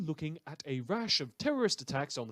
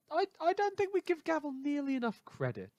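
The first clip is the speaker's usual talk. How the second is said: much higher, gushing fan voice; mock admiration; 14 semitones higher than usual. Radio host gushing to listeners about the vigilante Gavel, high mock awe. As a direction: swoon over him like a starstruck fan.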